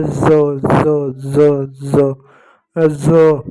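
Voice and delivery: ज repeated as a buzzing "za"; each ज as the z of zoo; dotted z sound. Shown as a demonstration. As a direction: drill ज with a z sound, pausing between each. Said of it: "बिंदी लग जाए तो हो जाता है ज".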